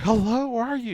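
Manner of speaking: high-pitched imitation of a panicked wife; frantic. Wife on the phone to her missing husband, frantic.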